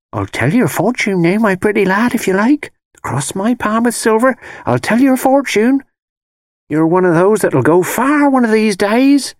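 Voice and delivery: high, whining fortune-teller patter; phony beggar's whine. A narrator's reading. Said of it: "voice drop to a kind of phony beggar's whine".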